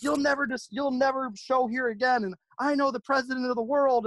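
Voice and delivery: high-pitched, ranting customer imitation; mock raging threats. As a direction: hurl threats as the outraged customer. Retold furious customer's threats.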